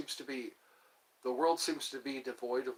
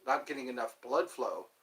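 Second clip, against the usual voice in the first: normal talking voice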